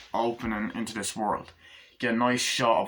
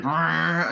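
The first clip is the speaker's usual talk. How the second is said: higher, louder growled engine noise; engine roar; 7 semitones higher, 5 dB louder than usual. Acted. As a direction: rev like a souped-up car. Imitating an engine in the chase.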